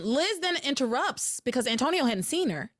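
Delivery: ordinary talk, nothing marked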